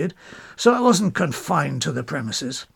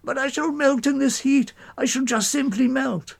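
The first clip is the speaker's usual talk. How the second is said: much higher, wailing like a goat; high bleating whine; 8 semitones higher than usual. Acted. Mowbray wails to the departing narrator, high and bleating. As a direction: plead in a high, bleating wail. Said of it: "bleating like a dashed goat".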